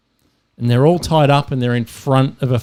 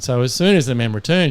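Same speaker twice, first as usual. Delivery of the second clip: ordinary talk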